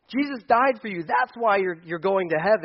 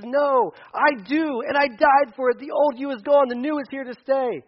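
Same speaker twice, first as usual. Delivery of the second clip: emphatic Jesus; 7 semitones higher, 6 dB louder than usual. Retold or imitated. Jesus answering the doubting believer, loud and emphatic. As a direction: insist the old you is gone. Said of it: "Jesus says".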